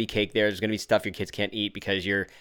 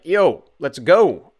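louder, slower, higher hype voice; eager fan; 6 semitones higher, 10 dB louder, much slower than usual. Retold or imitated. Dad imitating a listener's email asking for more podcasts, slow loud hype. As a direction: call it out like a fired-up listener. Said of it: "He was like".

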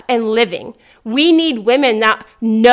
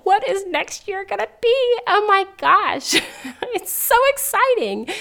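much higher, breathless with excitement; excited gush; 8 semitones higher, 3 dB quieter than usual. Real, not acted. Host dreaming aloud about the new year, thrilled squeal. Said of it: "I'm actually really serious when I talk like that".

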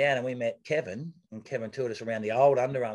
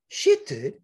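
much higher, louder shocked outburst; stunned disbelief; 13 semitones higher, 7 dB louder than usual. Retold or imitated. Guys on the grass realising he won the race, shocked and loud. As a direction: blurt it out in sudden disbelief.

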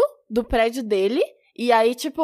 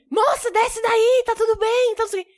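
imitating a firefighter; 7 semitones higher than usual. Firefighters coaxing girl down.

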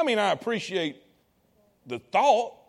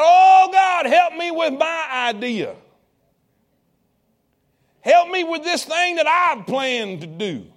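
loud, high pleading cry to God; imitating a loud, pleading prayer; desperate begging; 8 semitones higher, 8 dB louder, slower than usual. Congregation begging God for help.

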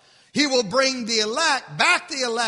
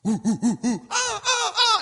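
imitated monkey hoots; 11 semitones higher than usual. Preacher imitating zoo animals for the congregation, playful hooting. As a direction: mimic baboons chattering in the jungle.

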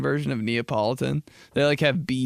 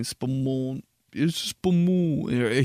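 Dad's overdone Italian "spumoni"; hammed-up Italian accent, drawn out; way too Italian; slower than usual. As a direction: stretch the middle of "spumoni" in mock Italian. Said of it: "make it way too Italian".

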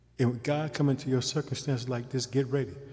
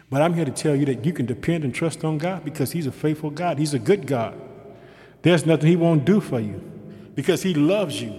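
loud, rising preacher's cadence; fervent sermon delivery; preaching; 2 semitones higher, 7 dB louder than usual. Acted. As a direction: preach it with full force. Preaching at full force.

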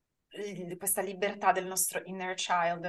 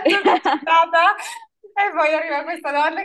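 loud, high, delighted laughing talk; laughing, much louder and higher, amused; laughing loudly; 10 semitones higher, 13 dB louder than usual. Real, not acted. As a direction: burst out laughing as you picture it. Laughing retelling her arrival.

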